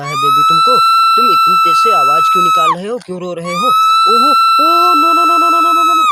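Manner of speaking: high, loud panicked cries of no; alarmed shout. Acted. Mother finds her baby crying, high alarmed shouting. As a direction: shriek in alarm as if the baby is hurt.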